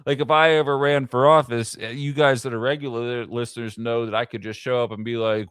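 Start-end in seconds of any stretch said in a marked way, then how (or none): none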